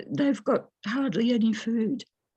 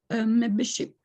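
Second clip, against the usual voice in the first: ordinary talk, nothing marked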